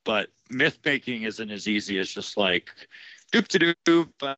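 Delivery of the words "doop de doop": high, slow, breezy nonsense sound; mock singsong. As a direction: toss it off like child's play. Mocking effortless art making.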